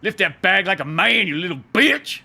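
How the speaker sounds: scornful, slow start then loud contempt; hammed-up tough-guy taunt; gruff mechanic sneer. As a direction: sneer down at the kid like a fed-up old mechanic. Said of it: "He's like".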